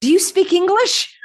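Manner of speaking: a touch of Southern politeness, asking gently; imitating polite stranger. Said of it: "he had a little Southern accent".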